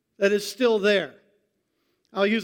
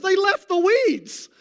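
high, slow, exasperated complaint; mock outrage; 10 semitones higher, 3 dB louder, slower than usual. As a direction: pastor joking to the congregation about his ruined lawn, mock outraged.

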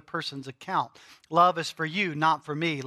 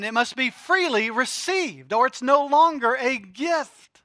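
higher, louder fervent preaching; preaching at full force; 7 semitones higher, 7 dB louder than usual. Acted. Preacher drives home grace to congregation, fervent and loud.

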